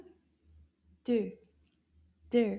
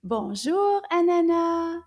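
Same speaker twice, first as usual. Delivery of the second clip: Bonjour, Anana called out loud and clear; big loud call of Bonjour, Anana; nice loud voice; 4 semitones higher, 8 dB louder than usual. Shown as a demonstration. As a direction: call Bonjour, Anana out loudly to summon her. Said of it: "Can you say that in a nice loud voice?".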